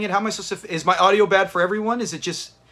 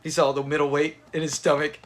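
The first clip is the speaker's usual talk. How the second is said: ordinary delivery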